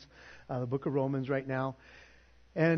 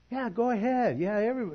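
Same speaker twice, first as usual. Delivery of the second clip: mock board members; 9 semitones higher, 4 dB louder than usual. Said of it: "They're like".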